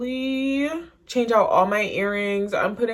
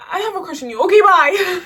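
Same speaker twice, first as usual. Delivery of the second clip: high, rushed blurt, louder on the goodbye; hurried confession; 11 semitones higher, 8 dB louder than usual. Retold or imitated. Confessing a crush then fleeing, hurried high blurt. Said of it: "I said it more coherently than that".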